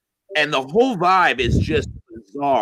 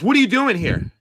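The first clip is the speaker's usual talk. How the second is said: raised-pitch challenge; mock aggressive demand; 8 semitones higher than usual. Drunk man challenging a newcomer at the bar, mock aggressive. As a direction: imitate a confrontational drunk.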